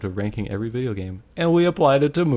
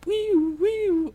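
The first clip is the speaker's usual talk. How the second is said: siren imitation. Mimicking police siren.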